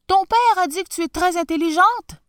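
high, loud call; shouting. Acted. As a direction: call across the field. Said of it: "lui cria le tsar".

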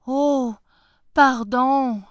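high, loud, startled apology; frightened apology. Acted. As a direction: pull back in fear. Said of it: "dit le fermier en pâlissant".

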